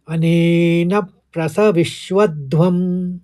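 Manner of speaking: "अनेन प्रसविष्वध्वम" drawn out syllable by syllable; slow careful pronunciation. Shown as a demonstration. Teacher models the third quarter, very slow. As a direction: say प्रसविष्वध्वम slowly, each cluster clear. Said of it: "आराम से निकलिए।".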